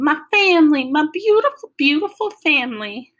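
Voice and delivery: high, loud, tearful Southern wail; weeping Southern grandma. A narrator's reading. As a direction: overcome grandma weeping over her family. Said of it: "with that Southern style accent and all the weeping".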